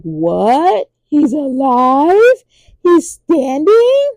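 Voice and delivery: high, slow, rising disbelief; shocked. Retold or imitated. Courtiers seeing the king alive, rising shocked disbelief. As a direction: let the shock grow with each question. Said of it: "everybody's, like, shocked".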